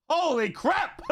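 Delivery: high surprised cry into a laugh; amazed laugh. Real, not acted. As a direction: gasp in disbelief then laugh. Reacting to the brawl.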